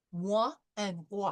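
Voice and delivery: roi with throaty French R then wa; French moi and roi. Shown as a demonstration. Teacher models Moi and roi for class. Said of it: "the French R ... followed by the wa".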